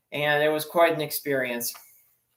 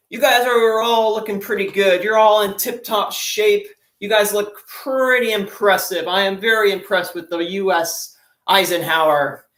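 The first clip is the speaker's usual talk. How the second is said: higher and louder, proud commanding officer; pompous admiral; 4 semitones higher, 5 dB louder than usual. Retold or imitated. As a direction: puff up like a proud admiral inspecting the crew. Admiral addresses the crew.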